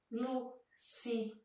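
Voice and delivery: Lu-ci-an-na spoken slowly and softly, one syllable at a time; syllables separated. Shown as a demonstration. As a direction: tap out Lu-ci-an-na syllable by syllable. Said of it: "sílabas que estão no meio".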